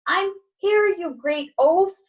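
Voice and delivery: high, loud, exasperated; irritated shout. Acted. Cat knocked off the chair to Harold, irritated shout. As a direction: yell it at a clumsy friend, drawing out the insult.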